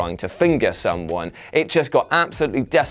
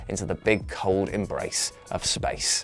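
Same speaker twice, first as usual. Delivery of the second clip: whisper; 5 semitones lower, 8 dB quieter than usual. Pretend. Dramatic whisper on space.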